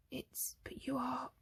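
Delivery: hushed, halting, astonished stammer; spluttering. A narrator's reading. Arthur stopped in his tracks by the visitor, soft slow spluttering. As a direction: falter slowly, barely getting words out. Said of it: "he spluttered".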